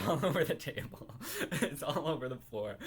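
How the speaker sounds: breaking into laughter mid-sentence; laughing through words. Real, not acted. To her viewers, recalling the soda spill, laughing. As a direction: giggle it out, can't hold it in.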